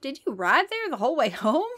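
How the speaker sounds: high, loud disbelieving question; disbelief. A narrator's reading. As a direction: ask it in disbelief. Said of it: "Luke asked in disbelief".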